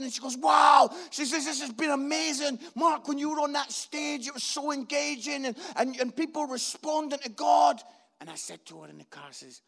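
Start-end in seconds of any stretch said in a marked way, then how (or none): 0.4-0.9 loud, higher-pitched "wow"; excited wife. Retold or imitated. Wife to preacher driving home after his first show, excited. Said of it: "my wife's excited". 1.4-7.8 gushing, higher-pitched retelling; excited wife. Retold or imitated. Wife praises husband in the car after the show, excited. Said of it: "my wife's excited, and she's talking".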